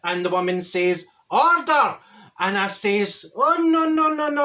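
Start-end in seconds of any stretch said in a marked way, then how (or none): 1.3-2.0 sharp high call of Order; shouting order. Retold or imitated. Judge calling the court to order, shouted. 3.4-4.4 protesting. Retold or imitated. Juror protesting to the judge.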